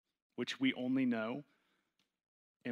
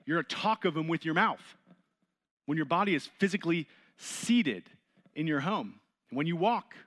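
louder, higher, driving preacher voice; fervent preaching; 4 semitones higher, 5 dB louder than usual. Acted. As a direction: preach it to the room with rising force. Preaching builds on scripture.

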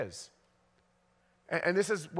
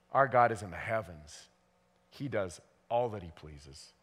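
scripture reading; 7 semitones lower, 4 dB quieter than usual. Acted. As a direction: let the psalm settle, softer at the end. Reads the psalm aloud.

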